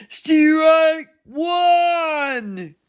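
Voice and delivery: full-voiced, high, drawn-out umpire shout; umpire's call. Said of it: "the umpire said".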